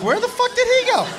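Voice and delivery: where did he go, squeaky with disbelief; baffled. Retold or imitated. Comic gapes at his vanished friend, high incredulous squeak. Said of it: "I was like".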